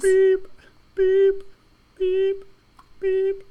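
very high loud beeping; reversing truck beeps. Acted. Truck backing up.

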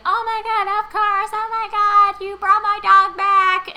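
very loud, high, hammed-up elation; overjoyed mock scream. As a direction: shriek with joy as the reunited dog owner. Girl gets her dog back.

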